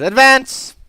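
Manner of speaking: high, loud one-word order; shouted command. Acted. Modron summoning petitioner, shouted command. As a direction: call it out as an official order.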